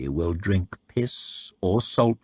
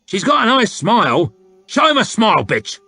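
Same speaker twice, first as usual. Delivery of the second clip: loud, higher coarse soldier voice, pushing a sale; crude haggling soldier; 6 semitones higher, 4 dB louder than usual. A narrator's reading. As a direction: wheedle and bully the buyer at once.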